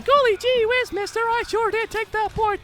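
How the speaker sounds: put-on chirpy old-timey kid gushing; wholesome 1950s kid. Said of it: "That's how I imagine that kid sounds".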